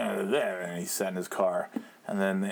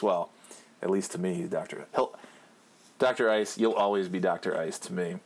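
usual speaking voice

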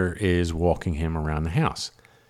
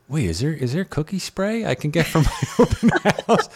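excited inner thought; 9 semitones higher, 3 dB louder than usual. Retold or imitated. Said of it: "I'm like".

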